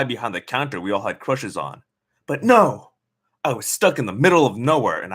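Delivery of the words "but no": louder, higher protest; exclaiming. A narrator's reading. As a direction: burst out in frustration. Inner outburst in narration.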